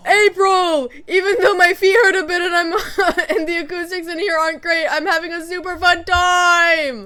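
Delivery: high, very loud shout to the performer; over-the-top concert cheering; full-volume fan yell. Acted. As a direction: bellow your support at the stage, drawing out the end. Acting a fan yelling into mic.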